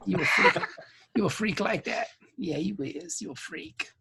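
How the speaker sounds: very soft, teasing near-whisper; mock seductive whisper. Same seducer, dropping to a whisper.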